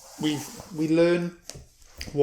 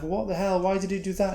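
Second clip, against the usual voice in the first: much higher, stunned disbelief; shocked outcry; 8 semitones higher, faster than usual. Retold or imitated. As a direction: companions reeling at a sudden kill. Party shocked at sudden killing.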